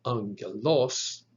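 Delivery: angelos slowly, stressed on OS: ang-gel-OS; ang-gel-OS, emphasis at the end; last-syllable stress. Shown as a demonstration. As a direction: punch the final syllable of angelos, rising. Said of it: "nor is it pronounced".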